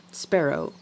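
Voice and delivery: ordinary delivery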